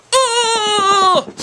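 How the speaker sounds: very high cry of pain; pained yelp. Real, not acted. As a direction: yelp as it rips. Ripping tape off again.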